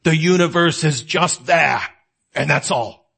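imitating Bertrand Russell's British accent, unhurried and dry; a smooth, posh-sounding English accent doing Russell; a mock British philosopher's accent, deadpan; sexy British accent. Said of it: "in his very sexy British accent".

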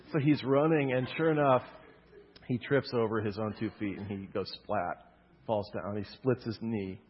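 ordinary delivery

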